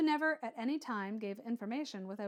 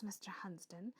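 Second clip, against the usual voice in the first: whisper; 4 semitones lower, 11 dB quieter than usual. Acted. Whispered name in dialogue.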